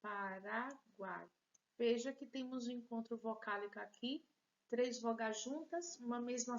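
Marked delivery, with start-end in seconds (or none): 0.0-1.3 Paraguai slowly split Pa, ra, guai; syllable by syllable. Shown as a demonstration. Teacher showing three syllables, Paraguai slowly broken apart. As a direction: count out three parts of Paraguai. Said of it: "Três sílabas".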